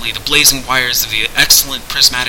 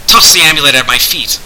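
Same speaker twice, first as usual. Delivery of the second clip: gloating; 3 semitones higher, 6 dB louder than usual. A narrator's reading.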